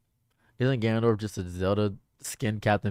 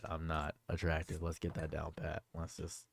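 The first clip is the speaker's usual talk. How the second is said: quiet; 5 semitones lower, 16 dB quieter than usual. Shown as a demonstration.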